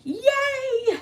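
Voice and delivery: very high, drawn-out yay; gleeful cheer. Real, not acted. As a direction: squeal a long happy yay. Cheering the start of crafting.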